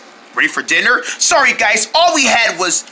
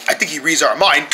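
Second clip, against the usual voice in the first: ordinary talk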